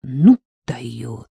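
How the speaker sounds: low, drawn-out marvelling; impressed, stretched-out exclamation; admiring mutter. A narrator's reading. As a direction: murmur it slowly in wonder. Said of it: "восхищённо пробормотал Стёпка".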